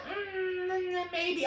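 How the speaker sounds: high, coy little fairy voice; tease, noncommittal and high. Retold or imitated. Fairy answers coyly.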